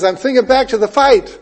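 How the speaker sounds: "fight" punched loud at the end; stress on FIGHT. Shown as a demonstration. Preacher voicing Paul looking back, heavy stress on "fight". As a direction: build up high and land hard on "fight". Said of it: "the emphasis is on the fight".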